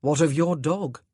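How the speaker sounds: husband asking dryly. Acted. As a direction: ask it dryly. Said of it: "he asked dryly".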